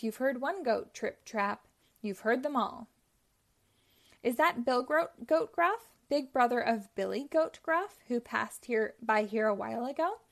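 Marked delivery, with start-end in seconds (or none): none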